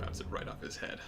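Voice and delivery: soft whispered suggestion; whispering. Real, not acted. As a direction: whisper it so no one hears. Sneaking past enemies.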